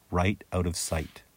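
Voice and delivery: ordinary delivery, nothing marked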